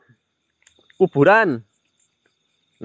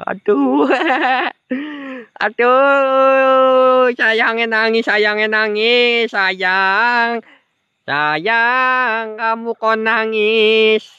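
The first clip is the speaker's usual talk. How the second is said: higher, slow whiny coaxing with a giggle; mock whimper; 10 semitones higher, much slower than usual. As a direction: play a weepy ghost for laughs. Mock crying, coaxing sayang. (merengek pura-pura sedih)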